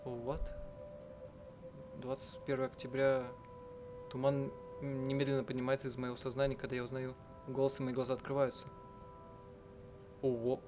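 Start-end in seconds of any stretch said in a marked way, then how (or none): none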